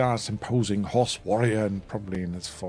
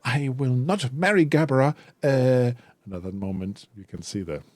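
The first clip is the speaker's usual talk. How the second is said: high, louder, forced boldness; a nervous son defying his father, pitched higher; shaky defiance; 6 semitones higher, 4 dB louder than usual. Acted. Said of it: "visibly shaking, but he puffs out his chest".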